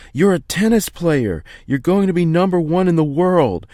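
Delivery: father roaring the plan, slowing on the last words; shouting at top of lungs. Acted. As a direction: bellow it at your son with everything. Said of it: "He shouts at the top of his lungs".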